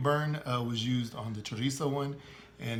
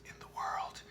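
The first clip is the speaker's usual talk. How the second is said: whisper; 8 dB quieter than usual. Real, not acted. Aside joke about sponsor.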